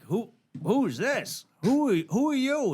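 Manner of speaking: high, slow, pressing questions; mock menacing demand. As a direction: lean on him hard, voice climbing high. Pressing the caller for his name.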